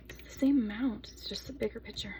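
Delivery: breathy confiding whisper, no voice; whispered aside. Real, not acted. Soap maker confiding to viewers that the photo is bigger, whispered. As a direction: whisper it like a little secret.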